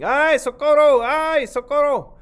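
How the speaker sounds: very high, loud, slow cry for help; snake screaming for help. Acted. The trapped snake screaming for help, high and loud. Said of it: "Culebra screaming".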